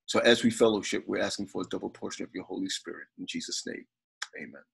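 quiet, earnest prayer voice. Acted. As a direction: pray softly over the family. Opening prayer at family gathering.

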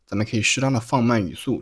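ordinary delivery

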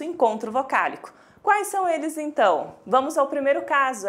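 ordinary talk, nothing marked

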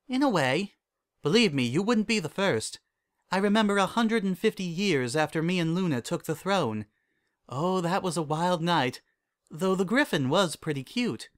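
high princess voice, reminiscing; Celestia, reminiscing princess. Acted. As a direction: recall a wild night with lifted pitch.

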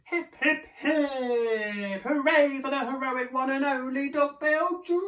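high, loud duckbill cheer; high celebratory chant; happy hooting. A narrator's reading. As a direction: hoot a joyful hurrah for a hero. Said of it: "the duckbills hooted happily".

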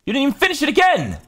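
high, loud, fast mock-parent scolding; scolding yell. Real, not acted.

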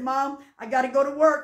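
ordinary talk, nothing marked

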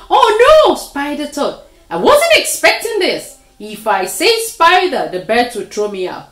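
high, loud alarmed inner voice; panicked thought. A narrator's reading. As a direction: panic at being found out.

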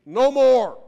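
loud, firm defiant shout; defiant refusal. Acted. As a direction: slam the door on them. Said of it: "push that tribute off and say".

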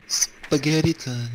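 meme voice. Pretend. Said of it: "can you say spaghetti time?".

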